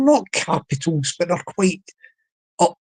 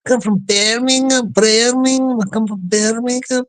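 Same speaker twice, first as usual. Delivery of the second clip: higher put-on Brummie lilt; Birmingham accent; 3 semitones higher than usual. Shown as a demonstration. Getting that voice right for listeners, Birmingham accent. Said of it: "you got to get that voice right".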